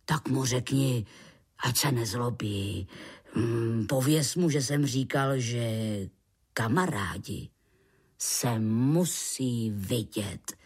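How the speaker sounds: low, measured man's voice; reassuring man. Acted. Man tells creature friends must meet, low reassuring voice. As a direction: offer friendship in a deep, calm voice.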